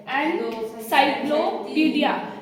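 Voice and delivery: Encyclopedia said slowly while counting its syllables. Shown as a demonstration. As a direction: spell out encyclopedia slowly for the class. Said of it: "तीन आवाजें आ रही है". (Encyclopedia धीरे से, syllable गिनते हुए)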